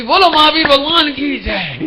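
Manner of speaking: high, loud, slow cry of joy; joyful shriek. Retold or imitated. Wife finally recognized, cries out in joy. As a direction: shout praise while clapping with delight. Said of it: "एकदम ताली बजा के चीखने लगी".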